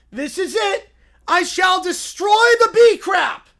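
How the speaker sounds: high, loud, triumphant cub; little bear vowing loudly; excited bear shout. Acted. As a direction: yell it like a sudden revelation. Bear declares.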